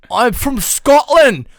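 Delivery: loud, high, slow hammed-up Scottish brogue; Scottish accent. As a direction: belt it out as a boozy Scotsman. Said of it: "trying to do an accent".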